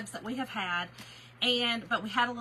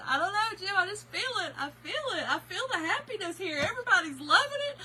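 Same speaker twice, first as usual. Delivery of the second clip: high, overcome retold wail; mock tearful; 8 semitones higher than usual. Wife explaining her crying to Jim, mock tearful. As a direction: plead it high, swept up in feeling. Retells tearful answer to husband at concert.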